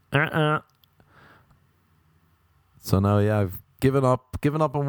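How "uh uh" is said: short two-note honk; vocal car-horn imitation; car horn noise. Shown as a demonstration. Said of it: "a car horn".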